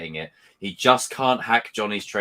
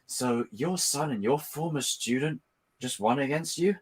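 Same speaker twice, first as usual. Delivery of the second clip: Silver taunting; 3 semitones higher, 7 dB quieter than usual. Retold or imitated. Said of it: "Silver's walking up like".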